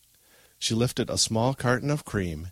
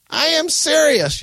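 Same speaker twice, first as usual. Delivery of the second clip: very high, loud, slow shout; yell; 10 dB louder than usual. A narrator's reading. Grandmother slamming the table, furious drawn-out yell. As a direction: yell every word at full force. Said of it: "yelled Grandma".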